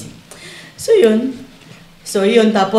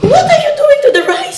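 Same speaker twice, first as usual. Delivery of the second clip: much higher, slow, laughing mock alarm; shocked employer; 5 dB louder, slower than usual. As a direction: play the baffled Irish boss, stretched out. Employer shocked at rice washing. (gulat na amo, mataas at mabagal, may tawa)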